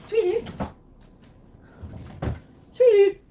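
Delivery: high, loud call for sweetie. Real, not acted. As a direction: call out sweetly across the room. Calling out to someone.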